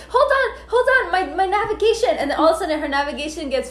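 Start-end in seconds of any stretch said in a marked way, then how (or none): 0.1-2.1 high-pitched, flustered imitation; flustered panic. Imitating Becca as her navigation fails, flustered high voice. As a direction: stall in a high, comic fluster.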